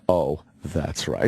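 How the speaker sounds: low, slow oh that's right; drawn out, deep sudden realization; deep mock realization. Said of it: "so it's not like".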